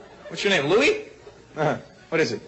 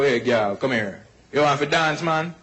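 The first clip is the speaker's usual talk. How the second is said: put-on Jamaican accent; 2 semitones lower, slower than usual. Jamaican guy to a girl at the bar, Jamaican accent. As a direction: do a Jamaican guy chatting someone up. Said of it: "Jamaican guys walk up to a girl".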